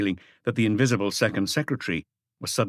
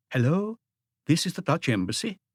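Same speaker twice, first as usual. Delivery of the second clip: put-on bright, higher voice with a slight Dutch accent; a cheerful young receptionist answering the phone; 6 semitones higher than usual. A narrator's reading. Embassy receptionist answers the inspector's call, cheerful and higher. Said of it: "young, cheerful voice with a slight accent".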